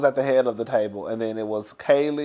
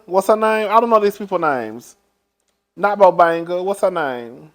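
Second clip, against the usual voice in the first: ordinary talk, nothing marked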